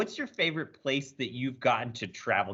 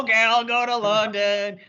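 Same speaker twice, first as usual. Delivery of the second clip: eager volunteer; 6 semitones higher, 7 dB louder than usual. Pretend. Mock volunteering for the trip.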